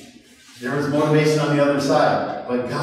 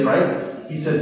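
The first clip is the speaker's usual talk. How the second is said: ordinary talk, nothing marked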